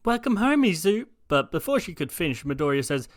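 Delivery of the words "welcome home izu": high, bright motherly greeting; a lightly voiced mum; mother's greeting. Mum greeting her son at the door, high motherly voice.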